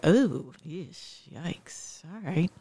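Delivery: hushed, awkward reaction, dropping almost to nothing; uneasy cringe. Acted. Reader reacting to Lot's story, uneasy cringe. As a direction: mutter it like an awkward reader backing away.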